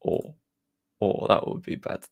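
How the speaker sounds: hushed little whisper of dread; mock worried whisper. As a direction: breathe it like a small panic. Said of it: "that moment was just a bit like".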